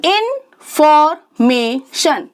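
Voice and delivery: Information slowly split into four beats; syllable by syllable. Shown as a demonstration. Teacher showing four syllables, information slowly broken apart. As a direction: pause between the syllables of information. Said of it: "four sounds यानी four syllables".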